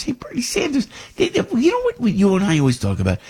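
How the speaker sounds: high, put-on Brooklyn Bernie Sanders voice; mock Bernie in a squeaky Brooklyn accent; hammed-up Brooklyn Bernie; Bernie impression. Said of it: "with the Bernie, uh, accent".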